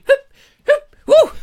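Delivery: high, loud hoops and a woo; mock startled yelps. Host imitates listeners coming up behind him, high startled yelps. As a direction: yelp as if jabbed from behind. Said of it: "You're all gonna be coming up going".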